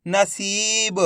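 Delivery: नसीब sounded out with a long ई; drawn-out long ई. Shown as a demonstration. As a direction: stretch सी in नसीब. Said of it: "खींच के पढ़ा जाता है".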